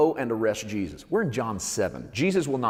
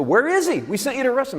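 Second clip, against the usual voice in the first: much higher, louder angry demand; indignant priests; 7 dB louder, faster than usual. Retold or imitated. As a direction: bark at the guards like an outraged priest. Chief priests scolding soldiers.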